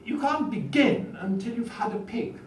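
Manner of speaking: put-on a touch of a plummy Etonian schoolboy accent; posh Etonian accent. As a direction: recite the comic verse in a hammed-up Etonian accent. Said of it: "in my best Etonian accent".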